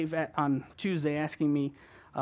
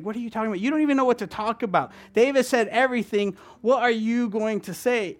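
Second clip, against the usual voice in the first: higher and louder, incredulous scolding; doubting head; 7 semitones higher, 7 dB louder than usual. Retold or imitated. His head arguing he has nothing to say, incredulous. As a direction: talk yourself out of it, disbelieving.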